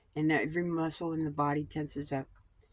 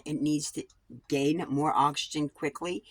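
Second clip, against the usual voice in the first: usual speaking voice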